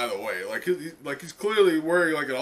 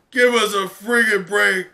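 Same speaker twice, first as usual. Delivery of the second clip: louder, higher desperate plea; pleading outburst; 5 semitones higher, 8 dB louder than usual. Acted. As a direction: beg them loudly for a break. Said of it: "she's like".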